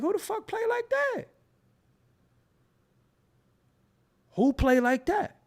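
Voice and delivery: exclaiming in shock; incredulous outburst. Retold or imitated. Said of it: "I'm like".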